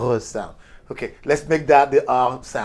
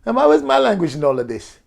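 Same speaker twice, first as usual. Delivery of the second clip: loud, high indignant objection; mock outrage, pitched well up; German guy; 7 semitones higher, 7 dB louder than usual. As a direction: protest loudly at being left out. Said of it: "But the German guy says".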